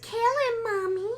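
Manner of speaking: put-on very high, creepy child voice; little kid voice. Host imitating the mother speaking for her son, little kid voice. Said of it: "like, in, like, a little kid voice".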